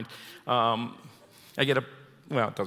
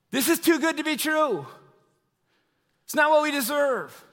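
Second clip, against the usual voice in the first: higher, joyful burst of wonder; excited disbelief; 9 semitones higher than usual. Acted. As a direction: let it spill out, almost too good to say. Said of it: "what I was feeling".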